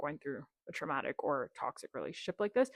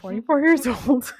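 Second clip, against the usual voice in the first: laughing; 6 semitones higher, 10 dB louder than usual. Real, not acted. Laughing at own story.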